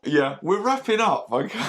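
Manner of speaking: laughing through the words; giggly high wrap-up. Real, not acted. Laughing after host joke.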